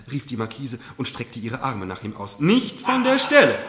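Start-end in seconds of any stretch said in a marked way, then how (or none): 2.4-3.6 loud, high order to stay put; stern command. Acted. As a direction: order her not to move, loud and sharp. Mother commands daughter stay.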